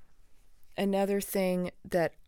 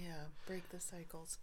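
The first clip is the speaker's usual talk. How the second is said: soft whispered catchphrase; 2 semitones lower, 18 dB quieter than usual. Pretend. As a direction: breathe the words out. Whispered sign-off phrase.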